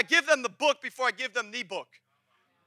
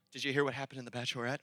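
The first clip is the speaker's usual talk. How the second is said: quick, soft, much lower gossip aside; gossipy whisper-quiet; 11 semitones lower, 11 dB quieter, faster than usual. Retold or imitated. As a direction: dish the gossip quietly and fast. Mock gossip quote.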